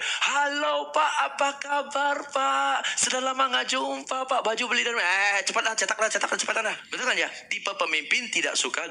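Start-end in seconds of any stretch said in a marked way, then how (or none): 0.0-5.1 high, chummy greeting to a boss type; overly chatty greeting. Shown as a demonstration. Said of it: "Coba kalau yang pertama, yang tipe pemimpin". 5.4-6.8 impatient boss. Shown as a demonstration. Impatient leader type demanding speed.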